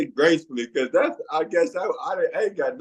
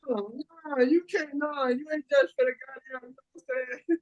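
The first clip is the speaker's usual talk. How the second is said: laughing; 7 semitones higher, 6 dB quieter than usual. Real, not acted. Laughing disbelief after quote.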